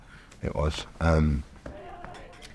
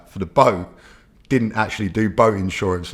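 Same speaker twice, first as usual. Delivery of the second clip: ordinary delivery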